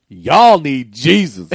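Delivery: slow, drawn-out sermon line; drawn-out preacher voice; slow preachy shirt voice. Pretend. Shirt's voice, laughing.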